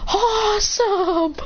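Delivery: high, very slow and stretched; mock delighted cheer. Delighted at controlling the eye power.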